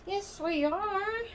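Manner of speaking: slow, high sing-song greeting with stretched words; sing-song show intro. Real, not acted. Host welcoming viewers to her rocking-chair show, sing-song.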